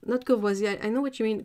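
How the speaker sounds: ordinary talk, nothing marked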